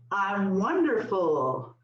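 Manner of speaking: slowly stretched affirmation; repeat-after-me. Shown as a demonstration. Leader giving the group a line to repeat, slow affirmation. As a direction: draw the words out for the group to echo. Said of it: "we're gonna say".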